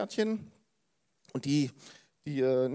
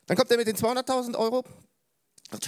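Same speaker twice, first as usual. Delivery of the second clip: usual speaking voice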